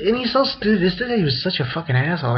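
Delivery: normal talking voice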